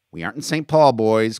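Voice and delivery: loud, high scolding; stern. A narrator's reading. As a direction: reprimand the boys sternly. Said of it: "Coates said sternly".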